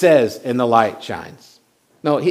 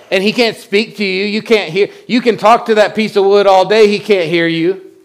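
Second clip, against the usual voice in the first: louder, higher scornful ridicule; mocking idols; 7 semitones higher, 6 dB louder than usual. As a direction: taunt the idol worshippers with scorn. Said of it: "he's almost making fun of them".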